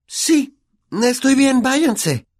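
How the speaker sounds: put-on very high, strained girl's voice, slow; hesitant, stammering refusal in a high pitch. Hermione behind the door refusing to come, high and hesitant.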